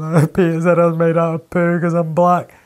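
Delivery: mock crying child's tattling; schoolboy tattling on Peter to the dinner lady, mock tearful. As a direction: whine it like a crying kid telling tales. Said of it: "he's crying, going".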